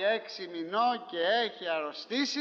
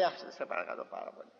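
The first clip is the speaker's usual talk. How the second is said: pleading whisper; 6 semitones lower, 6 dB quieter, faster than usual. Retold or imitated. Pleading quote rushed whisper.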